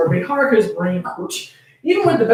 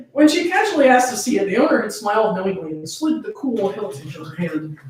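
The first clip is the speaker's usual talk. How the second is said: usual speaking voice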